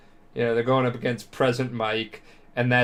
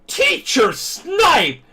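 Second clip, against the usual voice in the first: high, drawn-out scandalized shout; imitated girl's loud, high protest; shocked outcry; 5 dB louder, much slower than usual. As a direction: shriek it slowly in mock outrage. Acts outraged girl, accidentally touched.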